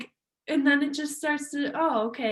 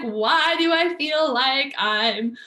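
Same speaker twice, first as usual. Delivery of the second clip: higher, louder, drawn-out giddy wonder; mock joyful excitement; 3 semitones higher, 5 dB louder than usual. Imagining a person flooded with joy and excitement, playfully excited. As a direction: act out being overwhelmed with excitement. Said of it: "total joy and excitement".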